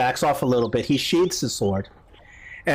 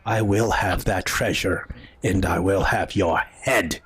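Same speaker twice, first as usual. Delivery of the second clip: low, slow threat, never truly screamed; mock scream; 6 semitones lower than usual. Admiral vowing to take the head, low menacing mock scream. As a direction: press each word out low and slow. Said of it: "he screams out".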